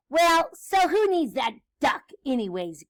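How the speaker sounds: ordinary delivery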